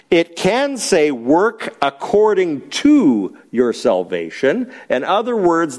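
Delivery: ordinary talk, nothing marked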